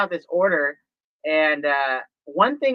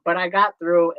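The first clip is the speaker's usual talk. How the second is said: usual speaking voice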